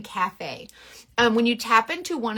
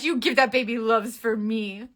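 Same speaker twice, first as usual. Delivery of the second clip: ordinary talk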